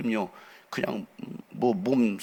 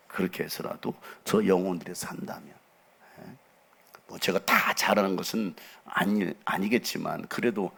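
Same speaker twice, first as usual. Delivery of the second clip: normal talking voice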